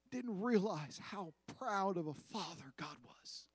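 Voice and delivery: hushed whispered aside. Acted. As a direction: whisper it like a tender secret. Tender aside about God's pride.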